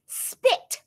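spat out in high disgust; a sharp, appalled little outburst; shocked disgust at spitting. Acted. Disgusted exclamation in reading.

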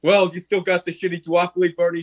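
mock brotherly bickering. Acted brothers' quarrel.

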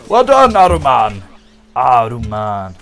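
"Aruman" stretched out, with a long final "maan"; mock-grand name pronunciation. Gamer congratulates his rival, grandly drawn-out "Aruman". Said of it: "I keep saying it that way".

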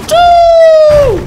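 very high, loud mock launch cry; cartoonish tchou launch shout; launch whoosh. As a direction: yell it like flinging someone away. Launching the little guy.

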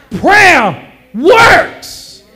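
high, loud pulpit cry; full-voiced, high-pitched proclamation; preacher's climactic shout. Real, not acted. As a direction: shout both words as the clincher.

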